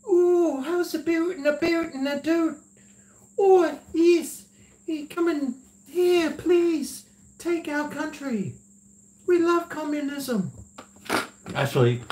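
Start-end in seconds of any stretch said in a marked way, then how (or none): 0.1-10.5 very high squeaky mocking voice; hammed-up falsetto, pitched far above normal; mock Canadians. Canadians welcoming a Chinese takeover, mocked in high falsetto. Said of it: "They'll be like".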